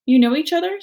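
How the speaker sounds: high, surprised little gosling; squeaky young bird asking. Acted. Gosling asking Roz about the otters, high and curious.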